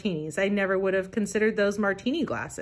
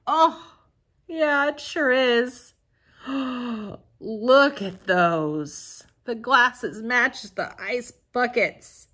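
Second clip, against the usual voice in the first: higher, louder, drawn-out delight; excited gushing; 4 semitones higher, 4 dB louder, slower than usual. Real, not acted. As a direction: gush slowly over each thing, overexcited. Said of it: "Calm down".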